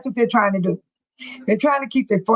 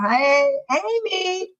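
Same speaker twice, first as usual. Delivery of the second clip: slow, drawn-out sing-song hello; sing-song greeting; 7 semitones higher, much slower than usual. Retold or imitated. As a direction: stretch the greeting out playfully. Reading chat greeting aloud.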